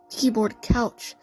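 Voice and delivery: ordinary talk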